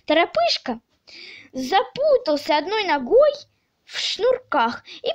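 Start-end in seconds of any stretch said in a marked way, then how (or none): none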